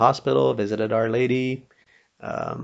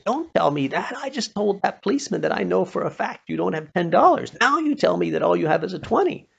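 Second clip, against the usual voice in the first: retold protest, pitched higher; indignant protest; 8 semitones higher than usual. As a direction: push back, exasperated and a bit higher. [name] protesting a misquote.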